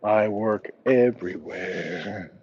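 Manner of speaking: slow, stretched "everywhere"; drawn-out repeat. Pretend. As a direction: draw out "I work everywhere" word by word, playfully. Repeats his answer drawn out for effect.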